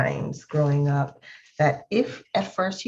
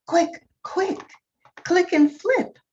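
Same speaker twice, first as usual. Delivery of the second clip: much higher, urgent call; urgent cry; 12 semitones higher than usual. Acted. Wise old beetle cries out urging the young one. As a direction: hurry him, pitched way up. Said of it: "cried the wise old click beetle".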